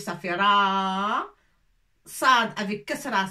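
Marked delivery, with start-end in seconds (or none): 0.0-1.3 "ra" drawn out slow and long; stretched ra. Shown as a demonstration. Teacher lengthening "ra" for the class. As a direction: hold the long a of "ra". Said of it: "ra avec alif".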